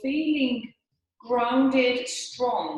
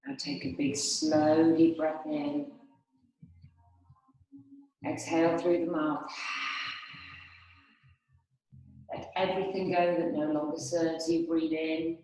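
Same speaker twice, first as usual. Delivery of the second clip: lower, unhurried breathing-meditation voice; guided meditation; 3 semitones lower than usual. Acted. Yoga teacher leading the class through breathing, guided meditation. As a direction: guide them calmly through each breath, leaving long pauses.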